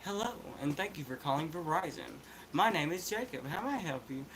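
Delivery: forced accent-free customer-service voice; no country accent. Shown as a demonstration. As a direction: recite it like a phone agent, country accent hidden. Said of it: "And it ain't".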